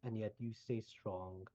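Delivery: calm kindly mentor, reassuring; gentle wise healer. Acted. NPC speaks gently, removing curse.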